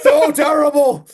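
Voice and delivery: loud, high outcry of disbelief; appalled shout. Real, not acted. Co-host reacts to the burning prom, appalled shout. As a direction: shout it out, aghast and half in fun. Real outburst at the film's ending.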